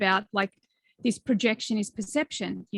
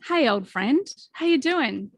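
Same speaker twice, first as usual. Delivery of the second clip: put-on higher, sing-song hello to an old friend; friendly greeting; 4 semitones higher, 3 dB louder, slower than usual. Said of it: "just be like".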